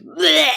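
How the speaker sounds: mock retching "bleh"; fake vomiting sound. Shown as a demonstration. Actor making a vomiting sound on request, mock retch. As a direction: gag out a fake throw-up noise. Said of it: "make a vomiting sound".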